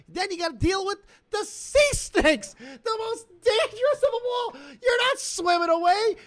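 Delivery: hammed-up rant climbing to a yell; building rant to a shout. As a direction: whip it up into a shout. Ranting rising into shout about snakes.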